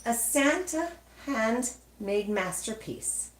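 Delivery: plain reading voice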